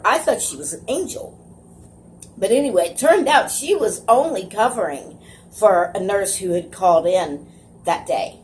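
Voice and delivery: normal talking voice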